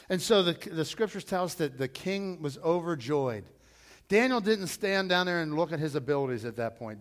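usual speaking voice

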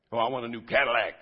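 loud, quick mock prayer; hammed-up wishing; mock greedy wish. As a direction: blurt it like a demanding believer. Mocks faith-in-faith prayer wishes.

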